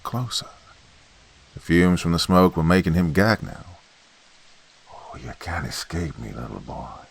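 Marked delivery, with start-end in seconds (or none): 4.9-7.1 much softer, slow menacing hiss. Acted. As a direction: taunt the trapped boy in a low hiss. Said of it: "the voice hissed".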